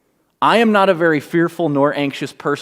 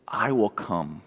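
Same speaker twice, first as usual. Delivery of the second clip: gentle promise; 5 semitones lower, 9 dB quieter than usual. Acted. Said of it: "And God says".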